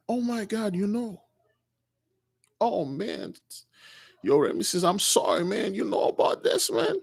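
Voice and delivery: soft, hushed acted shock; hushed disbelief. Prince realizing his friend knows the secret, hushed disbelief.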